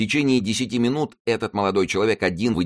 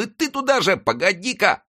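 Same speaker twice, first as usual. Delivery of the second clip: very high furious cry of pain; angry boy's yell. A narrator's reading. Friquet whipped by the coachman, very high furious yell. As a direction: curse the coachman who whipped you.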